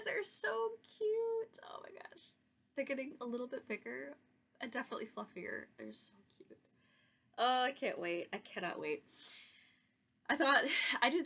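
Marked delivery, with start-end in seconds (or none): 0.0-1.6 very high, very slow, squealing cute voice; cooing over cuteness. Real, not acted. Gushing over the lambs. 1.6-2.2 breathless whisper of delight; whispered gush. Real, not acted. Gushing over the lambs. 5.8-6.6 soft unvoiced whisper of delight; whispered cuteness. Real, not acted. Gushing over the lambs.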